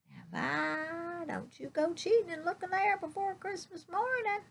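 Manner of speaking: high-pitched imitation of mama; mama's teasing warning. Mama warning child in retold story.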